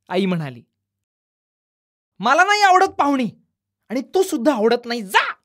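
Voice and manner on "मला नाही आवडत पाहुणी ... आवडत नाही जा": put-on sulky child shout. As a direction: shout it at mother, then push her away. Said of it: "यश ओरडला". (आईवर रागावून ओरडणारा मुलगा)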